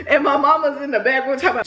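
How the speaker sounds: ordinary talk, nothing marked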